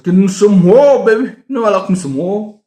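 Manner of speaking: hyped up. Real, not acted. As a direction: pump it up like a war fan. Excited about war history books.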